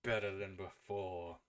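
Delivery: voice in the head. Acted. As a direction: speak low and measured to your chosen one. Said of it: "as you hear the voice".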